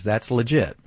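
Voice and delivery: ordinary talk, nothing marked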